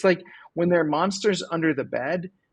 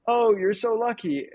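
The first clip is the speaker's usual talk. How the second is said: higher mock envious gush; gushing neighbors; 7 semitones higher than usual. Neighbors congratulating the old man on his luck, mock envy. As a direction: neighbors half-mockingly delighted, pitched up.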